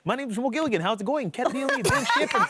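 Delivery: hammed-up Dutch accent, pitched high. Shown as a demonstration. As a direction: introduce yourself and the hosts in an over-the-top Dutch accent. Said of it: "Do that one again".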